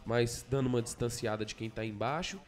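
usual speaking voice

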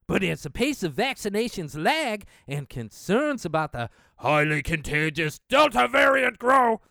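brisk newsreader reading of the report; news read. Acted. Reading the article to his viewers like a newscaster.

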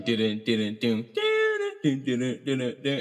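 beatboxing the bassline. Retold or imitated. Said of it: "it's got dun".

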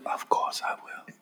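breathy library whisper. Retold or imitated. Quoting the librarian's whispered opening line, whispering. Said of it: "and she's whispered".

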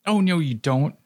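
high sharp shriek. A narrator's reading. Loki blocking the door from the escaping soul, shrieking. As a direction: cut him off with a shriek. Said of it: "shrieked Loki".

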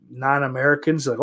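ordinary delivery

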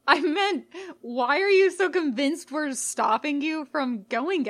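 can't believe what he's hearing; baffled. A narrator's reading. Baffled twin protests.

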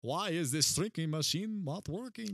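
mock exasperation in a put-on accent; Gru. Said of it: "or Gru".

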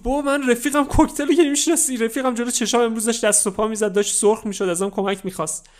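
high, loud distressed pleading; desperate pleading. Acted. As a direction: beg him to believe you. Kid pleads, friend died.

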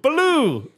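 "Baloo" high, falling on a long "ooh"; "Baloo" said to rhyme with blue; long "ooh" ending. Shown as a demonstration. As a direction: stretch the "oo" of "Baloo". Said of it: "it's an ooh".

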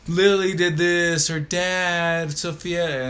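drawn-out mock tattletale; tattling kid. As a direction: imitate a daughter telling on her sister. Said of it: "they'll come in the room".

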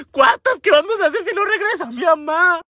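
lamento agudo y angustiado; pánico actuado. Retold or imitated. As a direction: pregunta desesperada, casi en lloriqueo exagerado. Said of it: "yo me empecé a locar". (angustia aguda de telenovela)

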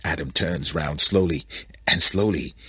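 ordinary delivery, nothing marked

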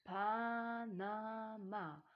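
PANAMA stretched syllable by syllable, Pa-na-ma; slow sounding-out. A narrator's reading. Deciphers word syllable by syllable. („Panama“ langsam Silbe für Silbe gedehnt)

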